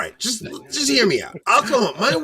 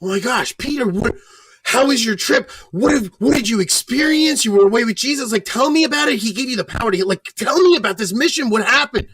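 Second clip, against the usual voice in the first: higher, bright eager questions; imitating an eager wife; 6 semitones higher than usual. Mimicking how the wife should greet Peter, eager and higher. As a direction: gush over his trip like a doting wife. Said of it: "she doesn't say".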